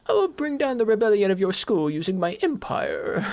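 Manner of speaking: Darth Little villain. Pretend. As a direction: play a pompous galactic emperor. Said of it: "for I am Darth Little".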